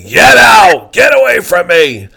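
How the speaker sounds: high, loud mock yell to get out; a hammed-up furious yell; retold screaming. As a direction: shout him away from the table. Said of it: "he started screaming at me".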